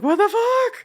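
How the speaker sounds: high, loud disbelieving yelp; shocked outburst. Retold or imitated. Friend imitating her bewildered reaction, high loud shock. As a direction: suddenly caught off guard by the tears. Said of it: "You're like".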